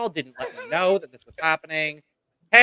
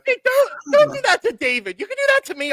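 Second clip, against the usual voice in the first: high-pitched protest. Real, not acted. Protesting the noise.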